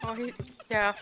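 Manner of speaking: whispering while hiding. Real, not acted. Hiding from the monster.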